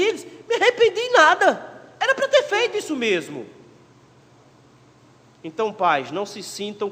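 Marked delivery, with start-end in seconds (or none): 0.5-3.5 high, louder, emphatic voice of God; God's retort. Acted. God answering Israel, high and emphatic. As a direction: snap it back, no regrets.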